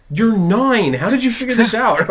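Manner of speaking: loud, high-pitched incredulous outburst; astonished. Retold or imitated. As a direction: exclaim in retold amazement at the kid. Amazed dad quizzing his child.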